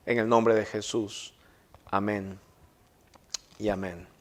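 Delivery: hushed, fading prayer voice. Acted. Pastor prays for the congregation and the parents, hushed and fading. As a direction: pray softly and let it die away to amen.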